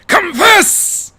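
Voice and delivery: loud high demonic roar; Rarity as the bat demon forcing a confession; growled command. Acted. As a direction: roar the order at the cornered gang. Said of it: "she growled".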